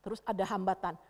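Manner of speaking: usual speaking voice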